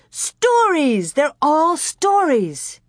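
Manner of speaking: high, drawn-out exclamation of delight; excited cry. A narrator's reading. Girl announcing her find of stories to her sister, excited cry. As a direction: cry out slowly, thrilled, pitch high. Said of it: "she cried".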